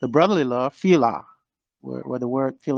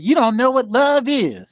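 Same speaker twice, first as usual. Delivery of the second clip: upset wife; 8 semitones higher than usual. Retold or imitated.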